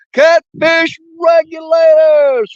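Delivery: slow, loud, stretched name call; drawn-out shout-out. Real, not acted. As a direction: call it out like an announcer. Shout-out to a viewer.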